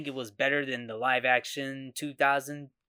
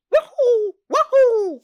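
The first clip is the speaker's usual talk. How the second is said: much higher and louder cartoon plumber yelp; 13 dB louder, slower than usual. Vlogger reenacting the trailer's jump cry, high loud Mario whoop. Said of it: "with two voices saying".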